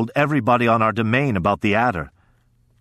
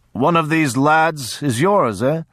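slow, lightly voiced hearty lord; a subtle insult said with a smile; sly jovial lord; 5 semitones higher, slower than usual. A narrator's reading. Lord sizes up the sons, lightly mocking. Auga's voice continues.

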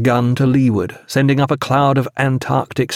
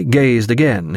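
ordinary delivery, nothing marked

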